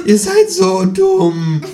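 very high, drawn-out loud squawk; loud high kangaroo squawk; croaking kangaroo. Acted. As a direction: croak it slowly in exasperation. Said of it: "krächzt das Känguru".

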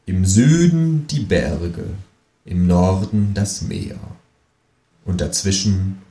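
slow, measured recitation with long pauses; solemn amateur poetry recital; declaiming a poem. Acted. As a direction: declaim it line by line, very slowly. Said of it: "Ich deklamiere".

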